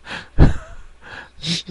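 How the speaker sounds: short high, breathless burst of laughter; real wheezy laugh. Real, not acted. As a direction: crack up, mostly air. Laughing at the callee's insult.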